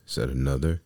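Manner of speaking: usual speaking voice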